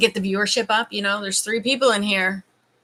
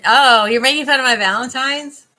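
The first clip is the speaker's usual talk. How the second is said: loud, higher indignant protest; mock offended; 4 semitones higher, 11 dB louder than usual. Real, not acted. Woman teased about her Valentine's pronunciation, mock offended. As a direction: call out the teasing, raised voice.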